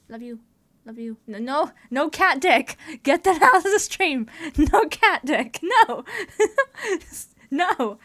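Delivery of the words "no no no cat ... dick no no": loud laughing protest; playful scolding. Real, not acted. Telling chat to remove a rude emote, loud playful scolding. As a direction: shout it down while laughing.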